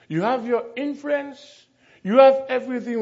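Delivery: ordinary talk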